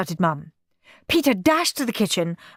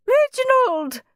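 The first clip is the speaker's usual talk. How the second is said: high-pitched cry of the husband's name; shocked, high wifely cry; horrified gasp. A narrator's reading. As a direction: gasp it out in alarm. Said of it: "gasped Missus Mossy".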